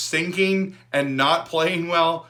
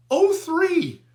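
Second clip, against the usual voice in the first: much higher, stunned; can't believe the score; incredulous; 12 semitones higher, 3 dB louder than usual. Real, not acted. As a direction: squeak it up high in disbelief.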